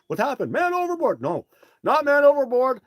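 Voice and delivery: very high, loud mock panic; panicked crew. Host playing crew shouting man overboard, high panicked yell. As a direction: yell it like frantic crew on deck.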